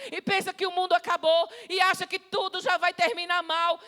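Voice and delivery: high, loud, driving sermon voice; impassioned preaching. Real, not acted. Preacher listing the fearful believer's panic, impassioned preaching. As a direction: pile up each clause with mounting urgency.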